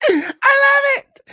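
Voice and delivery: very high, loud, slow laughing squeal; delighted squeal. Real, not acted. Host squealing over a viewer's crown.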